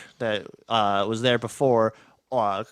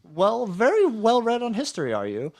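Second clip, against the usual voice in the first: a hint of a haughty stranger, higher; lightly voiced NPC; 10 semitones higher than usual. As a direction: raise the voice a little for the stranger. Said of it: "the guy will look at you and say".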